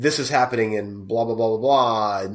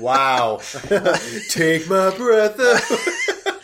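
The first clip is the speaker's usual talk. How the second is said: laughing through the words; 7 semitones higher, much slower than usual. Real, not acted. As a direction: giggle out a mock swoon. Reacting to a picture, laughing.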